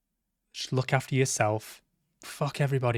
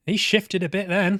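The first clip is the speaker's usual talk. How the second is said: excited; 7 semitones higher, 6 dB louder than usual. Real, not acted. Character speeds off in game.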